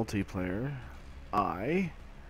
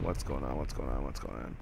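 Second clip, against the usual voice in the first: mock deep; 9 semitones lower, 7 dB quieter than usual. Repeating, dropping low.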